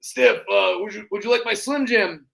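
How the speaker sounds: offering the wrestler a Slim Jim; Macho King shout. Retold or imitated. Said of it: "and I go".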